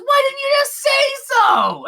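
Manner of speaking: high, loud kobold exclamation; delighted bartender. Acted. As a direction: cry it out high, suddenly won over. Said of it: "He goes".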